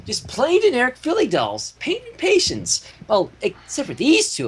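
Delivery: high, bubbly Pinkie; chirpy lightly voiced pony girl; giddy pony showing off dolls. A narrator's reading. Narrator voices Pinkie Pie.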